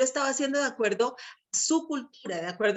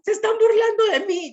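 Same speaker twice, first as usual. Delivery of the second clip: high, loud mock-crying kid voice; hammed-up childish wail, much higher and louder; imitated tearful child; 10 semitones higher, 7 dB louder, faster than usual. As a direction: imitate a little boy wailing, hurt at being laughed at. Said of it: "él se ponía a llorar".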